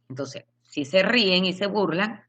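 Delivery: ordinary talk, nothing marked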